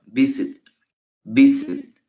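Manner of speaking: "visit" punched on VI; VI-sit, demonstrating where the accent falls; first-syllable stress. Shown as a demonstration. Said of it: "la fuerza la tiene en la primera sílaba".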